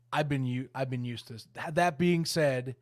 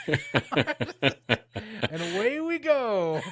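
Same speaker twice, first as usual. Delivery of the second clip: laughing; 4 semitones higher, 4 dB louder, much slower than usual. Real, not acted.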